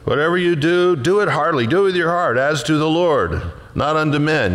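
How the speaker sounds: fervent preaching. Acted. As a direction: proclaim it like a revival preacher. Preaching the verse with force.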